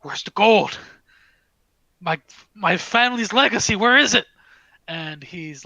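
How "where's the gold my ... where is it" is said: frantic outburst, loud and high; freaking out. Acted. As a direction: cry out in escalating panic. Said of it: "he is freaking out".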